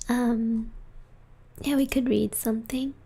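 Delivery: ordinary talk, nothing marked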